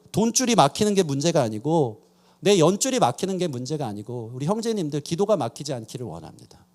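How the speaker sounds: ordinary delivery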